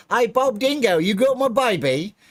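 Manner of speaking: very high, loud fake Aussie; terrible Australian accent. Streamer calling out to Bob Dingo, terrible Australian accent. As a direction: squawk it in a hammed-up Australian accent. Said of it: "It's terrible Australian accent".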